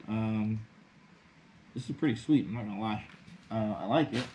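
muffled behind face mask; speaking inside respirator; through a gas mask. Real, not acted. Seen 5: Full-face gas mask worn over mouth throughout the labelled words.

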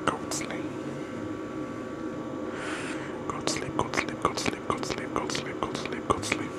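soft repetitive sleepy whisper; ASMR whisper. Acted. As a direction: lull them to sleep in a whisper.

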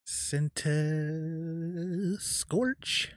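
drawn-out, very slow name with rising end; sounding it out. Real, not acted. Card opener typing a name in, slowly sounding it out. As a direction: stretch the word out slowly while spelling it. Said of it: "Make sure I spell it right".

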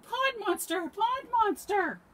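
high, very loud shouts; panicked cry. A narrator's reading. Hedgehog sees the pond monster, panicked shout. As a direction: yell a warning to flee. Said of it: "cried Hedgehog".

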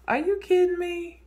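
can't believe what she's seeing; amused disbelief. Real, not acted. Telling viewers about her lazy dog, incredulous.

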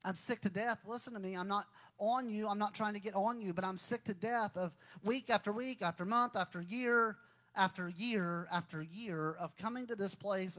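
rising sermon appeal; building exhortation. Real, not acted. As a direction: press the congregation harder with each phrase. Preacher exhorts the congregation.

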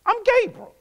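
offended angel with attitude. Acted. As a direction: throw the name like a rebuke. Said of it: "you got to see God's temperament".

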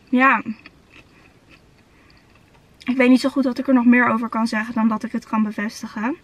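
eating nuggets between words; talking while chewing. Real, not acted. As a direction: mouth partly full of food. Seen 2: Bites nugget at -4, chews while talking early, bites again ~9.9.

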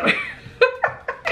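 squealing high laugh; high burst of laughter. Real, not acted. Laughing at the story. (risa aguda)

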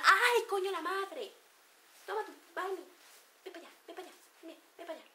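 a touch of mock annoyance, then trailing away; annoyed brush-off. Someone irritated at being bothered, high mock exasperation.

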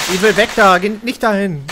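urgent shouted warning; being chased. Real, not acted. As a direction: yell the warning under fire. Warning teammate while under fire.

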